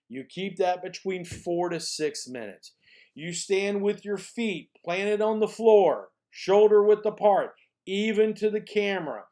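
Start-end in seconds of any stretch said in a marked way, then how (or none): none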